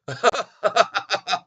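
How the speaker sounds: loud, high laughing fit; burst of laughter. Real, not acted. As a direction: explode laughing at the headline. Laughing at the Yahoo headline.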